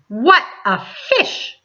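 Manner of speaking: slow amazed exclaim with a laugh; wide-eyed wonder. A narrator's reading. Policeman amazed.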